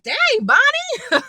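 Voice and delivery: high, drawn-out exclamation of amazement; amazed reaction. Retold or imitated. Viewer reacting to Bonnie's boldness, amazed. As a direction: stretch it out high, mock astonished. Astonished reaction to the show's character.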